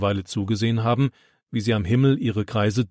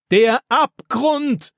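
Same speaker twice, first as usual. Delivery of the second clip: drawn-out, much higher scream; roaring in fright; 13 semitones higher, 4 dB louder, much slower than usual. A narrator's reading. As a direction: cry it out slowly in horror. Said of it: "brüllt Florian".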